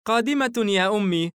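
put-on high young girl's reply; smiling girl. A narrator's reading. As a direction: answer mother like an obliging daughter. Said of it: "ثيشا باسمة لأخيها".

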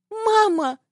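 put-on very high childish cry. A narrator's reading. Frightened son calls his mother, high-pitched cry. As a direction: cry out for mother in a small boy's voice. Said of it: "по ушам резанул новый вскрик сына".

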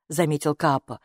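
usual speaking voice

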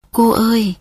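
ordinary delivery

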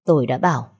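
plain reading voice

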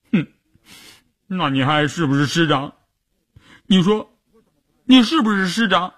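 high-pitched tearful demand; tearful sobbing. A narrator's reading. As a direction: press the question through sniffles, high-voiced. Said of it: "一边哭， 一边抽打着说".

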